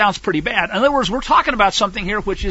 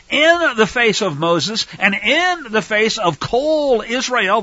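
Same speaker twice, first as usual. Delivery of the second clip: ordinary delivery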